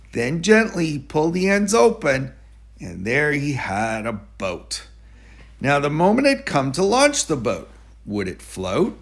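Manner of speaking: ordinary delivery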